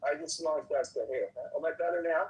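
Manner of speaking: ordinary talk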